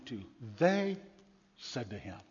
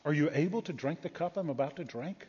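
soft, grave, quiet reply; Jesus gently correcting a mother; gentle Jesus; 5 dB quieter than usual. Acted. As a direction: answer her quietly and gravely. Jesus answers softly.

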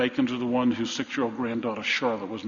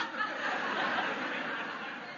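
soft breathy laugh; 10 dB quieter than usual. Real, not acted. Laughs at his own joke.

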